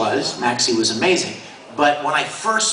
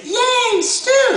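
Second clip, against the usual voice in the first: Al cheering. Retold or imitated. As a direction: squeal it like the script says. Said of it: "She was gonna say".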